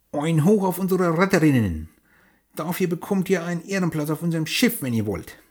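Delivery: loud, high pirate cheer; old sailor. Acted. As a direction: raise a hearty toast for the heroines. Pirates cheer their rescuers.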